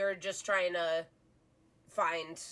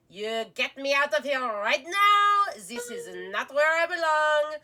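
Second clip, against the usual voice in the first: angry little high voice; pixie; 8 semitones higher, 10 dB louder than usual. Acted. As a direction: shout it as a furious tiny captive. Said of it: "you hear a little voice going".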